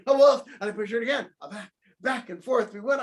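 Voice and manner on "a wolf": high, loud yelled alarm. Acted. Grandma yells at the wolf, high loud alarm. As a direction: yell it to raise the house. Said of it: "She popped back out saying".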